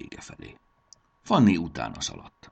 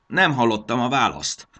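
raised, loud, croaky demand; croaking gnome; 7 semitones higher, 9 dB louder than usual. A narrator's reading. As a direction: croak impatiently at the intruders. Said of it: "recsegte a manó". (recsegő, hangos manóhang)

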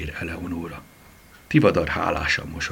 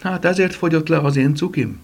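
sighing, higher-pitched lament; 11 semitones higher than usual. A narrator's reading. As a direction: sigh first, then a raised, plaintive question. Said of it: "sóhajtott Franci néni".

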